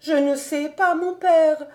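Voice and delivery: understated storyteller's tearful wail; sobbing. A narrator's reading. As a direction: answer as a girl in tears, pitched high. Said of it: "fit-elle en sanglotant".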